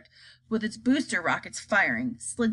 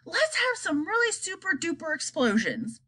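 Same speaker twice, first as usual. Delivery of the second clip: eager army chief; 7 semitones higher than usual. Acted. Army chief wants explosions.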